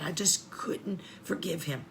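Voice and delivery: barely voiced regret; whispered confession. Real, not acted. Mother admitting she never forgave her son's father, whispering.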